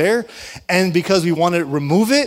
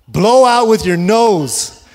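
slow, firm, spelled-out instruction; 4 semitones higher, 5 dB louder, slower than usual. Retold or imitated. Father slowly instructing his daughter, firm and deliberate. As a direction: drag each word out for a toddler.

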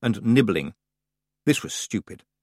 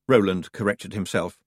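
ordinary delivery

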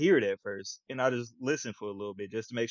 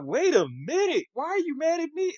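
much higher, baffled "Wait a minute". Retold or imitated. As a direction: squeak it out like you got yelled at for nothing. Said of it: "I was like".

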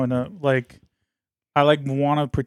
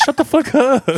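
much higher, louder playful comeback; mock exasperated retort; 12 semitones higher, 5 dB louder than usual. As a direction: throw out a squeaky, joking brush-off. Retold snappy reply about the gas.

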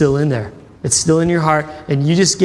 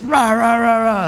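higher-pitched wordless mock rant; imitated ranting; 6 semitones higher, slower than usual. Preacher imitating a man who won't listen, mock ranting. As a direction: rattle off nonsense like someone ranting.

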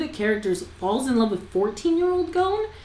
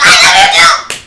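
hammed-up love yell; 8 semitones lower, 11 dB louder than usual. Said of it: "And he'd be like".

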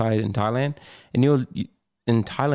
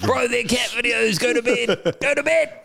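much higher, mock-outraged dad voice; mock exasperated dad; 13 semitones higher than usual. Dad scolding son about cat videos, high mock outrage. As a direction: ham up the fed-up father. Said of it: "in my head, I'm like".

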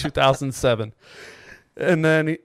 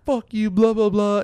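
higher-pitched mock scolding; half-hearted retold tirade; retold cussing out; 6 semitones higher than usual. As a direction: imitate someone cussing out a stranger. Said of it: "started cussing him out".

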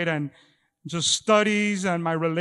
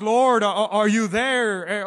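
louder, slow pleading question; desperate prayer; 2 semitones higher, 6 dB louder, slower than usual. Retold or imitated. Preacher voicing a hurting believer's prayer, pleading. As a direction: reach out to God, aching.

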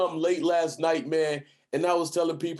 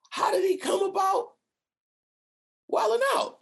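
quoting friends' question. Retold or imitated. Friends' question, high shouted quote.

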